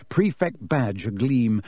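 ordinary delivery

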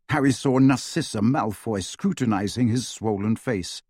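plain reading voice

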